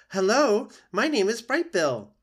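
high, loud chirpy greeting; a gosling introducing himself to anyone; proud little gosling. Acted.

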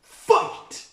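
high, loud announcer call; booming fighting game announcer. Acted. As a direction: call the round start like an arcade game. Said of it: "you just hear a".

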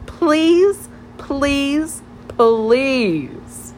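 high, drawn-out begging; wheedling plea, starting high and sliding down; pleading. A narrator's reading. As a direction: beg playfully, stretching each please. Friend begs Natasha to write.

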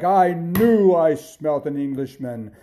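loud, high, drawn-out giant outburst; roaring giant. A narrator's reading. Giant waking as Jack runs off, loud angry cry. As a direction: bellow it slowly at the thief. Giant wakes and gives chase.

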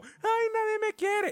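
high, slow whiny wail; mock self-pitying whine. Host mocking the depressed girl at the party, high and slow. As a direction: ham up a sad girl's wail.

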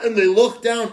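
ordinary talk

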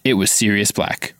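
ordinary delivery, nothing marked

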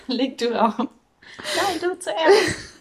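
high giggly lovers' tease; giggly late night call. Pretend. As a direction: coo like a teen on the phone. Lovers' hang up parody.

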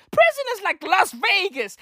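ordinary talk, nothing marked

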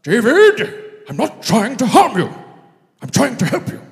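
high, loud wise-wizard voice; Gandalf impression. Nathan the prophet as Gandalf reassuring David. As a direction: boom it out like Gandalf to the king. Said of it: "It's my Gandalf".

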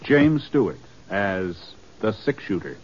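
ordinary talk

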